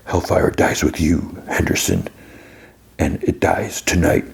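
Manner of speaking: slow, rough-voiced retold threat; menacing threat. Bully holding Dustin by the face, slow mock menace.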